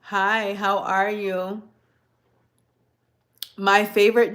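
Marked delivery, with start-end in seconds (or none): none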